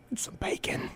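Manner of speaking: unvoiced whisper to end the line; mock Walken whispering at the dinner table. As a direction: let the words fade into a whisper. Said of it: "I'm like".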